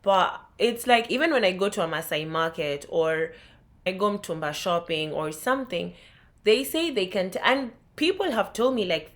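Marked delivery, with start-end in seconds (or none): none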